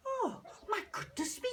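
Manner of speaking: high amazed cry. Acted. Old lady marveling at the pool. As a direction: gasp it out in wonder. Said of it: "she said".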